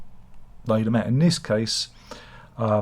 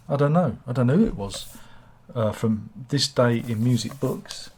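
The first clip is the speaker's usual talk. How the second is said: normal talking voice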